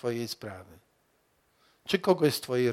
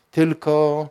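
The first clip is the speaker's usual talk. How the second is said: normal talking voice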